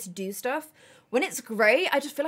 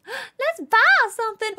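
high, loud, stretched-out excitement; slow high squeal of excitement; excited; 10 semitones higher, 6 dB louder, slower than usual. Real, not acted. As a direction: gasp and sing out with glee. Excited shopping in game.

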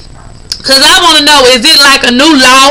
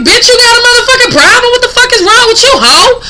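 fast, high, heated confrontation; angry retold retort; 8 semitones higher than usual. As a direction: snap it back fast and high, daring her to answer. Retells cursing out the other woman.

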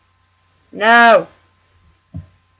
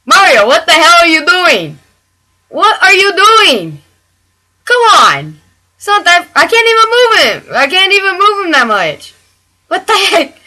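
high, loud exasperated shouts; higher, louder frustrated yelling at Mario; 7 semitones higher, 3 dB louder than usual. Real, not acted. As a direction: plead and scold Mario at once.

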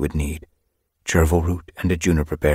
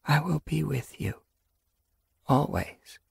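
high, softer tender vow; whispered; 10 semitones higher, 6 dB quieter than usual. Acted. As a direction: soothe the babies softly. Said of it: "she whispered to her unborn babies".

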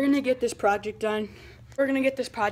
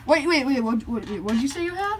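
sudden eager double take; excited; 3 semitones higher, 3 dB louder than usual. Acted. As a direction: stops him, suddenly keen. Skit, excited about camera.

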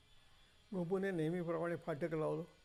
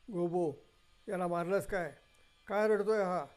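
louder accusing yell; shouting neighbour; 7 dB louder than usual. Acted. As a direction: call out sharply at the guard. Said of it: "कोणतरी ओरडलं".

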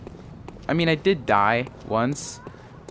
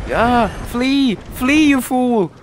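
very high, loud wail; frantic high-pitched shout to run; panicked fleeing cry; 10 semitones higher, 6 dB louder, much slower than usual. Real, not acted. Fleeing an enemy.

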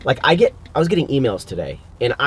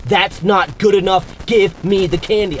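louder, higher, slow whiny demand; a hint of a bratty customer; mock demanding customer; 6 semitones higher, 4 dB louder than usual. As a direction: act out a greedy, pushy customer. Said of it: "And they're like".

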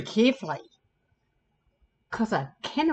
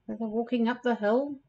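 usual speaking voice

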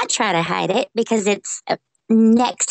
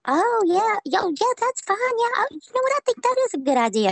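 much higher, eager mock-agreeing judge voice; imitating the judge; 13 semitones higher than usual. Social worker mimics judges agreeing with her, high and eager. Judges won over in courtroom.

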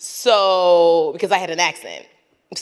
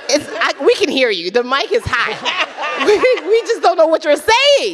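high, raised-voice telling-off through laughter; amused exasperation; 10 semitones higher, 4 dB louder than usual. Real, not acted. Comic to someone on a hot mic, laughing exasperation. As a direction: call it out, exasperated but laughing.

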